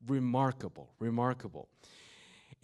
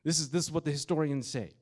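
higher, louder preaching; full-force preaching; 5 semitones higher, 7 dB louder than usual. Acted. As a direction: preach it with fire.

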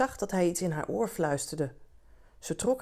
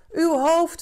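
much higher, louder demanding shout; crowd shouting; 11 semitones higher, 8 dB louder than usual. A narrator's reading. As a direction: yell it up at him from the crowd. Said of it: "riepen ze". (eisend roepen, veel hoger en luider)

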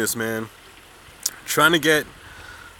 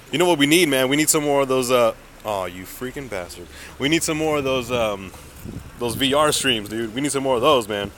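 normal talking voice

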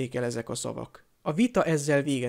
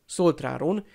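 ordinary delivery

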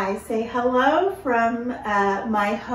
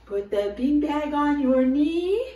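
ordinary delivery

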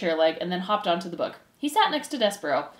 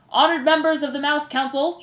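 loud, raised formal address; shouted; 7 semitones higher, 6 dB louder than usual. Acted. Furlow addresses the Mouse Council, shouted. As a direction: proclaim it to the whole hall. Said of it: "shouted Furlow".

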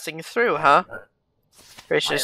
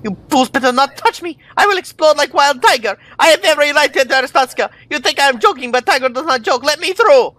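angry foreign entrant; 8 semitones higher, faster than usual. Acted. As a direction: play the furious traveller who won't leave. Streamer voices angry entrant.

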